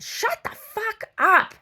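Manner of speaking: high, loud, drawn-out yell; exasperated outburst, re-enacted at high pitch; retold angry shout. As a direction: yell it slowly, hitting each word. Retold quarrel, telling him off.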